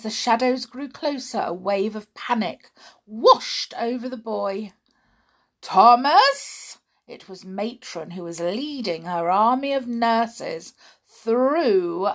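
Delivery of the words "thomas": louder, sharp call; loud stern call. Acted. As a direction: call him through the dark. Matron calls through basement.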